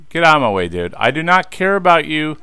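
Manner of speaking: loud, fed-up yelling at the guards; irritated shout. Real, not acted. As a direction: bark them out of the way, annoyed. Shoving past guards in game.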